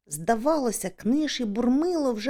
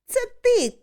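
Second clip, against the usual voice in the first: high, loud retort; indignant high-pitched shout; shouting back; 5 dB louder than usual. A narrator's reading. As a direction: yell the blame straight back. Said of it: "кричить він".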